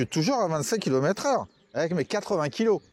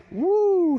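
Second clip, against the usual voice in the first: excited whoop; 5 dB louder than usual. Real, not acted. Exclaims at top speed.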